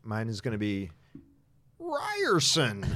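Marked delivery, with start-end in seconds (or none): 1.8-2.8 high-pitched drawn-out name called as a buzzer. Pretend. Player showing the group their chosen buzzer sound, high-pitched call. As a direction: sing out the name like a game buzzer. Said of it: "come up with a buzzer sound".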